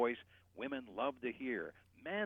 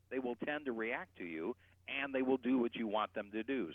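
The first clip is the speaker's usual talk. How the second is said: normal talking voice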